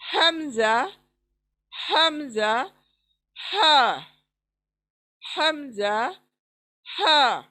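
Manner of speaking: हमजा with a glottal catch, हा breathed from the chest; hamza and ha from the chest. Shown as a demonstration. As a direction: recap lowest-throat letters. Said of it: "सीने के तरफ के हिस्से से". (सीने की तरफ से हमजा और हा)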